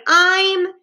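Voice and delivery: high, loud shout broken off. A narrator's reading. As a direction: start shouting, then cut short. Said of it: "he shouted".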